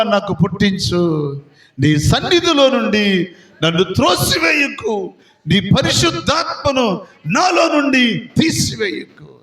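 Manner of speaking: high, fervent pleading psalm prayer; David crying out to God for a clean heart. Acted. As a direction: plead to God with rising intensity. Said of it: "ఏడుస్తూ, మొరపెడుతూ అంటాడు".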